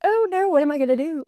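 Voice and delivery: high, quick retold worry; playful little panic; mock panic. Retells panic on getting role.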